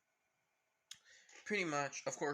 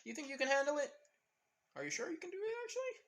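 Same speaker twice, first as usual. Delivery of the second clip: climbing far higher with every phrase, doubtful; teasing Naruto; 13 semitones higher, much faster than usual. Retold or imitated. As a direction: needle him, rising way up in pitch. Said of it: "With Naruto saying".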